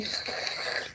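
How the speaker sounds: som de pigarro raspado do alemão; imitando escarro. Shown as a demonstration. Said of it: "parece que eles estão escarrando". (pigarro gutural de imitação)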